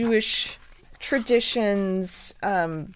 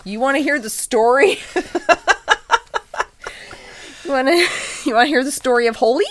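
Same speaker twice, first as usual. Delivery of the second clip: playful calling out; 6 semitones higher, 8 dB louder, faster than usual. Retold or imitated. Mock calling to adults.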